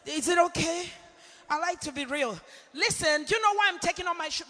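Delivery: loud, fervent sermon delivery; preaching. Acted. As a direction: preach it with full conviction to the congregation. Preaching sermon at full force.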